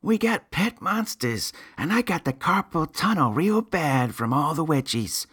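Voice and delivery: put-on very high, nasal-free little boy voice; kid voice. A narrator's reading. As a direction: squeak it out like a small boy.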